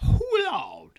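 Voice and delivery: high falsetto echo of her friend; mocking echo. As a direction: parrot the line back way up high. Echoing a friend's exclamation.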